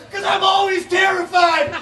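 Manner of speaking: very high, frantic yell; terrified butterfly. Pretend. Butterfly telling the princess it is always terrified, frantic high yell. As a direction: shriek it in panic.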